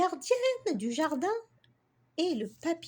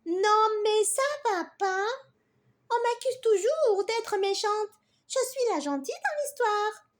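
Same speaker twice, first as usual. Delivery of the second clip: higher, louder huffy bee voice; offended bee; 6 semitones higher, 4 dB louder than usual. Acted. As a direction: protest like a wounded little bee. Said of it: "L'abeille vexée". (abeille vexée, ton indigné)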